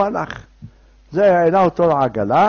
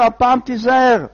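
high, louder caution; stern warning; 6 semitones higher, 5 dB louder than usual. Acted. Helper hands back the candle, stern raised warning. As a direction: warn him sharply, voice raised. Helper warns him once more.

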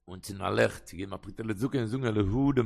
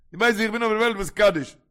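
higher, louder retort to a father; indignant, raised-voice objection; protesting son; 9 semitones higher, 10 dB louder than usual. Acted. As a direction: protest with the voice pushed up.

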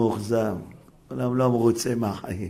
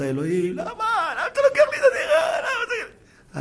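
crying out to God. Retold or imitated. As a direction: wail at God in hammed-up protest. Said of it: "ואתה צעקת". (צעקה מוגזמת ומצחקקת אל אלוהים)

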